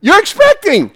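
high, loud revival preaching; preaching at full force. Real, not acted. Preacher firing up the ladies about expecting a baby. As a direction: preach it with everything you've got.

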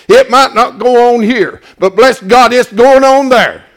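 building, loud, pounding repetition; preaching at full force. Acted. Preacher to congregation, growing into a loud, fervent shout.

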